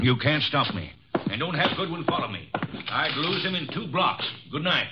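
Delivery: defiant. Acted. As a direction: dare them to stop you.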